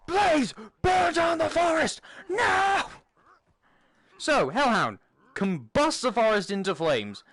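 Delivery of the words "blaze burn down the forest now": high, slow shouted command. Retold or imitated. Ordering his Hellhound to burn the forest, hammed shout. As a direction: bellow the order at the hound. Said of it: "Blaze, which is the name of my Hellhound".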